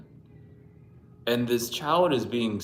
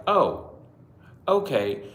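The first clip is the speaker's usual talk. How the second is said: mock surprise; 5 semitones higher, 5 dB louder, much slower than usual. Shop staff reacting.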